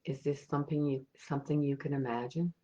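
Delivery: soft, unhurried meditation voice with long pauses; guided meditation. Acted. Meditation leader guiding listeners on an imagined ocean walk, soft and calm. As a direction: lead listeners gently inward, leaving space after each thought.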